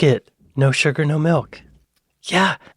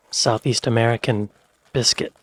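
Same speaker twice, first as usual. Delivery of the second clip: ordinary talk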